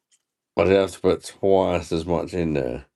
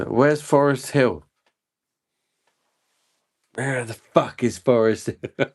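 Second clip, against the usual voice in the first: imitating cousin; 6 semitones higher than usual. Mimicking a cousin who keeps asking where Forest Hill is. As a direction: ham up the cousin's puzzled ask. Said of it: "Cousin used to say".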